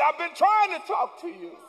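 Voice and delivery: loud, high exasperated cry; God's shout. Acted. God calling out to a distracted churchgoer, shouting. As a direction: shout it as God losing patience. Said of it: "And he said".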